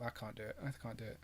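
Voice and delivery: ordinary talk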